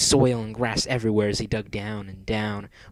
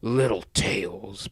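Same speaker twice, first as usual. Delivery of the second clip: slow, whispery menace; drawn-out, whispered villain coo; purring; much slower than usual. A narrator's reading. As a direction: purr it at your captive. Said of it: "purred Eggman".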